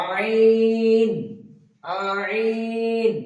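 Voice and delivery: আইন drawn out long from the middle of the throat; throat letter demo. Shown as a demonstration. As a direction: hold আইন slowly, squeezed from the throat. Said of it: "বলুন আমার সঙ্গে সঙ্গে আপনারা বলুন".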